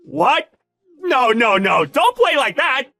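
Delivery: high, loud alarmed protest; frantic denial, voice shooting up; panicked disbelief. Acted. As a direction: cry out in sudden panic. Boss learns it is CEO's wife.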